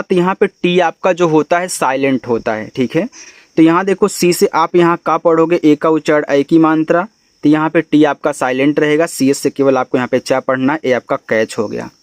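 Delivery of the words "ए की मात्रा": ordinary delivery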